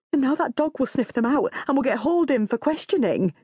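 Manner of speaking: high, plaintive Janice voice; moaning. A narrator's reading. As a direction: moan on in anxious self-pity. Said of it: "Janice moans".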